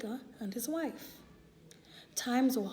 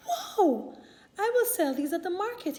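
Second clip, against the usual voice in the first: excited; 7 semitones higher, 4 dB louder than usual. Acted. Shoemaker amazed by two pairs.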